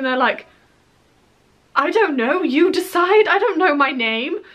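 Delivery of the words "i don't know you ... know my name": high, flustered babble, retold; flustered panic. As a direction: replay the panic of forgetting your own name. Flustered when asked her name.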